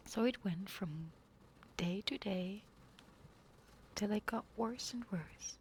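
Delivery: ordinary delivery, nothing marked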